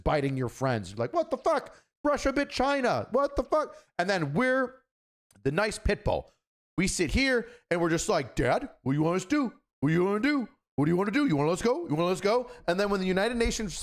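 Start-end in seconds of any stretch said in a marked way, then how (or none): 1.1-3.8 high, incredulous what the fuck; shocked disbelief pitched way up; mock outrage. America reacting to the chihuahua Russia biting China, high and shocked. 8.3-12.5 eager pit bull. Pretend.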